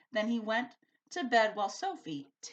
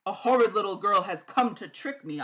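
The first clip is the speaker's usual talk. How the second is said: bellowing giant; 12 dB louder than usual. A narrator's reading. The giant bellows as he smells Sophie coming. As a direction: bellow out the rhyme. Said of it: "The giant bellowed".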